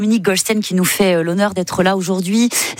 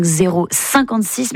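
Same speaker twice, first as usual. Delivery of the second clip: normal talking voice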